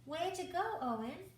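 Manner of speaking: high, loud cheer; cheering cry. A narrator's reading. Frog praising the young snake with a high loud cheer. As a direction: cheer him on proudly. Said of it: "she cried".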